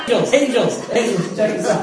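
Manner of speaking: loud, hammed-up belly laughing; having a ball. God calling angels to watch that kid, having a ball. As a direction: call out between high peals of delighted laughter. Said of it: "he's probably having a ball".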